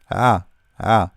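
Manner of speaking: Ah as the throaty Arabic ain; pronunciation demo. Shown as a demonstration. Teacher repeats the hard letter for learners, pronunciation demo. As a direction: sound out Ah as a separate throat-made letter. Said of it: "difficult letter to pronounce".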